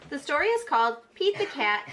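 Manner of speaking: ordinary delivery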